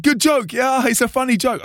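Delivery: high, insistent prankster voice; Flo. Retold or imitated. Prankster explaining himself to seething singer, high teasing voice. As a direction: imitate a friend delighted with his joke.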